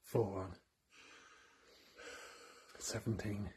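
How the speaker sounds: hushed live-stream chat; talking quietly. Real, not acted. Counting viewers as they join the live, quietly. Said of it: "Why are we talking so quiet?".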